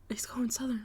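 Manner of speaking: whispered aside. Real, not acted. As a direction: hiss it under the breath. Aside about own accent.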